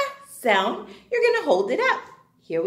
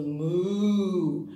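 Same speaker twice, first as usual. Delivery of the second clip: cow moo; 6 semitones lower, 5 dB quieter than usual. Retold or imitated. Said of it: "and the cow goes".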